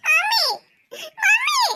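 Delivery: high wailing call for mummy; crying for mummy. Acted. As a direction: wail mummy like a hurt little girl. Hurt child calling for mummy. (കരഞ്ഞുകൊണ്ട് മമ്മിയെ വിളിക്കുന്നു)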